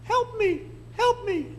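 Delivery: very high, squeaky cry for help; tiny little voice. Retold or imitated. Frog calling up to the old man, very high squeaky plea. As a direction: squeak it like a tiny creature begging. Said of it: "he hears this little voice say".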